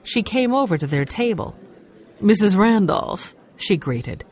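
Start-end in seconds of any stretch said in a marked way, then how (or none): 2.2-3.3 phony sweet greeting. A narrator's reading. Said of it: "Wearing a phony smile".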